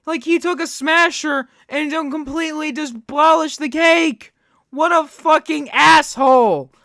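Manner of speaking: outraged. Real, not acted. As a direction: swear in outrage, punching the key words loudly. Reacting outraged to the cake being destroyed.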